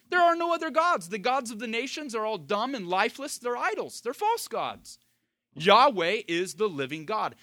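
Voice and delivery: forceful, high-pitched sermon declaration; emphatic preaching. Real, not acted. Preacher denying other gods to the congregation, emphatic.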